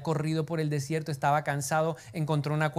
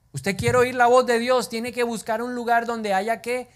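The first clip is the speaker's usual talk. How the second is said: emphatic; 7 semitones higher, 5 dB louder than usual. Real, not acted. Preacher exhorting congregation.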